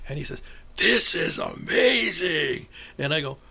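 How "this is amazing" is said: slow, drawn-out, higher exclamation; amazed preacher. Retold or imitated. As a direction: savour every word in imitated awe. Preacher's amazed reaction retold.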